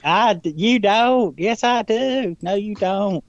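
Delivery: high-pitched squabbling imitation; exaggerated high mock voice; mocking a friend's voice. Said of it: "Do I really talk like that?".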